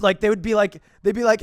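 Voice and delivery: usual speaking voice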